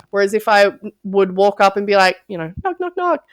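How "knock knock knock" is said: a playful high knock, knock, knock; bright door-knock chant; high sing-song knock. Retold or imitated. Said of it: "Again, this is my pitch".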